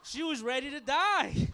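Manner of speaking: loud, high and drawn out; exasperated yell. Acted. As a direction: holler it in comic disbelief. Comic yells punchline after act-out.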